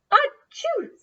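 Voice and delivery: big high acted sneeze; loud sneeze. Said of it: "said Papa Grunt".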